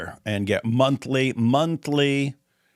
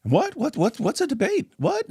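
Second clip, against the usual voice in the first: mock confusion; 13 semitones higher than usual. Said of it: "God Logic shows up".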